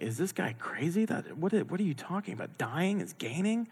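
high, hushed disbelief; incredulous. Acted. Baffled listener reacting to Paul's words on dying, incredulous. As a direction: question it in a soft, high, baffled voice.

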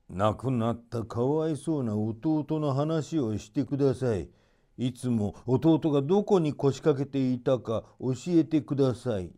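a lightly voiced scheming old stranger; sly old sorcerer. A narrator's reading. Sorcerer feigns grief.

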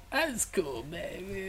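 high, breathy, too-cool drawl; cool greaser voice. Retold or imitated. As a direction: play it smooth and aloof. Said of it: "he's like".

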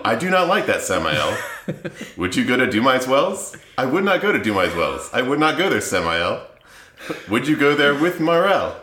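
sing-song Seuss-style rhyme recital; lilting storybook cadence; reading a children's book. Acted. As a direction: read it like a silly picture book, bouncing on the rhythm. Reads parody children's book aloud.

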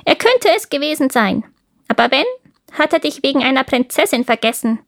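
a hint of crow chatter; raspy cawing crow. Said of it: "sagte die Krähe".

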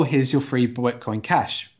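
usual speaking voice

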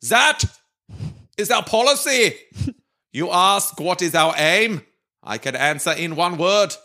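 orate grandly in a mock German accent. Reading Churchill's war speech on request, in a German accent. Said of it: "with a German accent, as requested".

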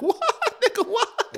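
squealed, laughing disbelief; astonished. Retold or imitated. Retelling the speaker fix to a friend, astonished. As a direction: act out amazement in a very high voice. Amazed that the trick worked.

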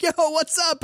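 high, breezy mock-casual hello; imitating a buddy saying hi; casual Rex greeting. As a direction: toss off a friendly bro greeting. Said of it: "for Rex to be like".

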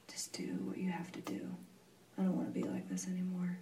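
quick, exhausted whisper; whispered prayer. Retold or imitated. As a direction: whisper it, giving up. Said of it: "I'm just like".

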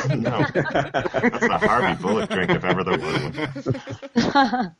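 laughing through the words. Real, not acted. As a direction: say it while cracking up, slowly. Laughing about Harvey Bullock's drink.

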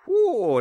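high loud ghost whoo; ghostly whoo. Pretend. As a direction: shiver it out like a ghost. Spooky chill sound.

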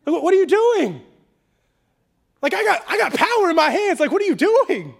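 high, loud, incredulous pleading; hammed-up exasperated outburst; exasperated Pilate. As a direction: shout in disbelief at someone refusing your help. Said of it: "that's the attitude of Pilate".

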